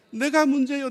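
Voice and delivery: 내가 문제예요 declared high and very loud; emphatic answer. Shown as a demonstration. Preacher answering own question, 내가 문제예요 loud and emphatic. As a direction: hit 내가 문제예요 loudly as a firm admission.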